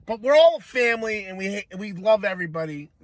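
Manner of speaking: ordinary talk, nothing marked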